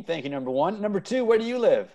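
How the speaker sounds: ordinary talk, nothing marked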